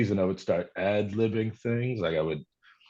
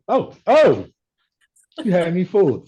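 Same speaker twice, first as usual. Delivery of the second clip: much higher and louder, taken in; a big surprised gasp of realisation; amazed staff; 11 semitones higher, 7 dB louder than usual. Retold or imitated. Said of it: "they just kinda be like".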